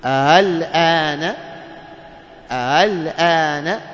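"الآن" very slowly, second hamza softened toward h; eased hamza. Shown as a demonstration. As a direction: glide through the second hamza of الآن, drawn out. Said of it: "كيف ننطقها بالتسهيل". ("الآن" بتسهيل الهمزة الثانية ببطء)

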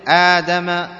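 آدم slowly, with a long "aa"; slow drawn-out "aaadama" for آدم; madd vowel. Shown as a demonstration. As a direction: stretch the first vowel of آدم. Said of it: "وكذلك ما مثلها".

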